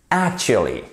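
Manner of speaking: "actually" with the weight on AC, short and clipped; stress on first syllable. Shown as a demonstration. Teacher shows French learners the English stress. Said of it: "Vous avez un accent tonique sur la première syllabe".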